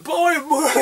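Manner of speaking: high put-on voice calling boy; squeaky call from a stranger; mock call. Someone in the crowd calling out, in a high mock voice.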